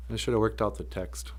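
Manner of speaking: normal talking voice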